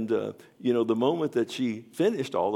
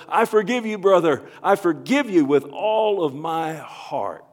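yelled forgiveness; 8 semitones higher, 10 dB louder than usual. Retold or imitated. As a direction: cry it out with all your heart. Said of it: "and I yelled".

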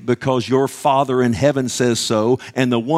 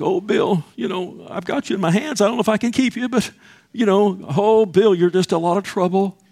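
lightly voiced God, fretting over Bill; a mock-weary God who can barely hold on; half-joking God voice, higher, complaining about Bill; 6 semitones higher than usual. Preacher voices God mocking him.

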